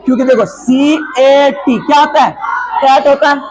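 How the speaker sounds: usual speaking voice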